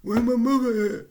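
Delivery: loud high call; calling for mom. Retold or imitated. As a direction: shout for your mother. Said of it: "the first thing I'm thinking".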